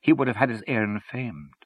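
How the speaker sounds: slow, measured elegy; hushed, evenly paced poem; solemn recitation. A narrator's reading. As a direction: recite it as a grave lament. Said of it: "then began to recite".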